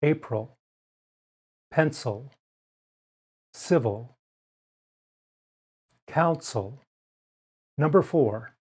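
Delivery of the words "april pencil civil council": "pencil" ending said with a weak uh; careful word list; schwa demo words. Shown as a demonstration. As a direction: model each word slowly. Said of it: "with the sound uh".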